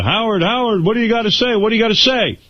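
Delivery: high, eager reporter calling out; a hint of a pushy TV reporter. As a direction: shout the questions like a reporter chasing him. Reporter shouting questions at Howard.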